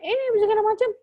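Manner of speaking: imitated loud, high child outburst; child's loud voice. Older child demanding things from mother, loud high yell. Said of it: "kalau kakak bersuara keras".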